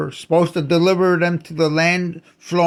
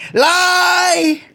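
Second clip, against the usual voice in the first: much higher, loud accusing yell; furious shout; 12 semitones higher, 4 dB louder than usual. Real, not acted. Ranter yells that the promise was a lie. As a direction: hurl the word as an accusation.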